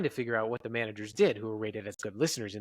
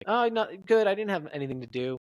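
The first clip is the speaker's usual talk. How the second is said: high, louder opening that settles; animated reply; 8 semitones higher, 3 dB louder than usual. Shown as a demonstration. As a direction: react big on the first words. Acted answer contrasted with flat one.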